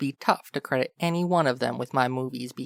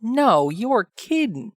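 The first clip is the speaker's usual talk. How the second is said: slow, higher, louder feigned shock; mock gasp of surprise; 7 semitones higher, 5 dB louder, slower than usual. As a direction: ham up the disbelief. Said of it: "You gasp dramatically".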